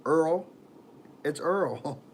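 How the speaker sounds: oil said as "Earl" in a put-on accent. Shown as a demonstration. Streamer testing how to say oil, "Earl" in a put-on accent. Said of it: "I was doing a stupid accent".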